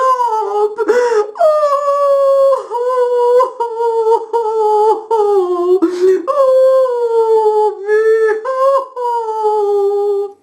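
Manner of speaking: loud, high wailing oh's; howling sob. Acted. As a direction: wail like a heartbroken faun. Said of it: "begins to howl and sob".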